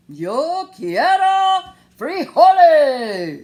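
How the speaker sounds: loud, slow, drawn-out proclamation; somber declaration. Acted. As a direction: declare it grandly and slowly. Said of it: "in his most somber voice".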